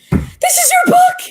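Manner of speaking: very high, loud squeal; shocked delight. Retold or imitated. Returning teacher discovering the student's book, very high squeal. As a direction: squeal it in amazed joy.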